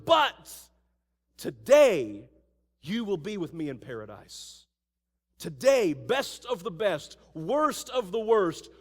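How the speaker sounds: loud, punched "today"; slow, weighty promise; emphatic Jesus. Acted. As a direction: declare it loud to the thief. Jesus answers the thief.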